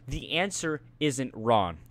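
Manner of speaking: drawn-out "answer isn't wrong", word by word; slow, deliberate quote. Retold or imitated. Student retelling teacher's baffling verdict, slow and deliberate. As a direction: repeat the teacher's verdict slowly for effect.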